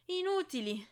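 high, loud furious snarl; growled through teeth. A narrator's reading. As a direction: burst out with rage on "Inutili!". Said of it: "ringhiò fra i denti".

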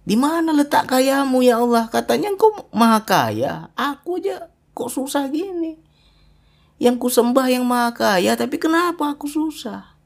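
mock protest demanding where God's riches are; raging at God. Preacher acts a believer raging at God over poverty. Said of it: "Ngamuk kita sama Allah".

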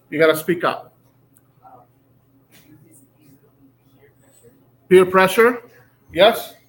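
loud classroom call-out; projecting loudly. Real, not acted. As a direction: raise voice over the room. Students too quiet, teacher projects.

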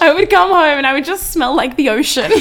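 laughing. Real, not acted. Laughing about fish smell.

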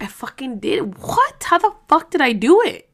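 disbelieving, pitch jumps way up on what; mock astonishment at her own strength; amazed at herself. Remembering what she survived, stunned and amazed. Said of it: "But you're like".

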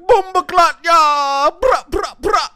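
high, very loud mock patois outburst. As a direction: belt out a hammed-up fake patois, punching each sound. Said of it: "then his son's like".

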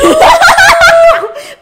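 high-pitched shriek of laughter. Real, not acted. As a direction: burst out in a squealing laugh. Friend's shocked laughter.

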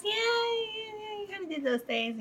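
high, soft hedging voice; Irvin. Retold or imitated. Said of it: "And he goes".

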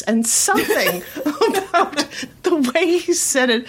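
real laughter breaking in; laughing. Real, not acted. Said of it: "put me on the floor laughing".

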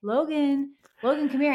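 calling the niece. Retold or imitated.

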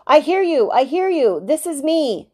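eager reply. A narrator's reading. Boy answers the radio eagerly.

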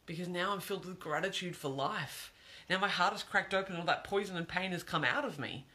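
ordinary delivery

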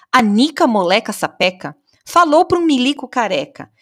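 poem reading. A narrator's reading. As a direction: recite nonsense verse with animated storyteller energy. Recites four limericks.

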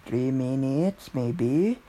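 ordinary talk

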